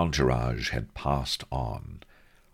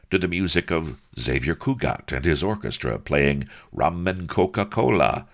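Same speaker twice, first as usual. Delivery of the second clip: ordinary delivery, nothing marked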